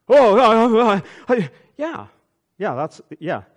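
high, loud flustered stammering; stunned stammer. Retold or imitated. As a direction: caught off guard, fumbling for words. Deacon stunned after being dismissed.

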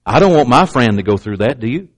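ordinary talk

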